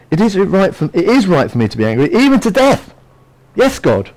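mock-indignant, climbing high; defiant prophet answering back; angry talking back. Jonah arguing back at God, hammed-up indignation. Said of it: "he's speaking back to God".

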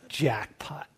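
a hushed, breathy aside to himself; whispered jackpot. Retold or imitated. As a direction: whisper it like you just struck gold. Excited inner reaction to attack.

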